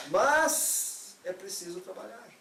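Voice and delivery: MAS long and loud, pause, then slow resolve; stress on turning MAS. Shown as a demonstration. Urging flawed disciples to work, long emphatic MAS. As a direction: stretch "mas", hold, then deliver the duty. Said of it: "mudar essa entonação do mas".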